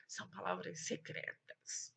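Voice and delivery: playful secret, barely voiced; secretive hush. Teacher hints at secret words.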